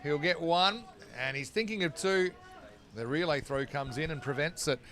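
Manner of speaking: quick, lifted cricket call; live play-by-play. Acted. Commentator cutting back to the game, lively play-by-play. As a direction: follow the ball and call the run.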